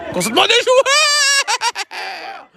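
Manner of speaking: high, slow, stretched shout; excited drawn-out shout. Acted. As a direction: stretch it out like a stadium call. (cri prolongé et aigu)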